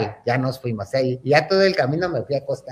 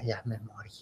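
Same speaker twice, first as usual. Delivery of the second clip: faint murmur; 5 semitones lower, 18 dB quieter than usual. Retold or imitated. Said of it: "decía".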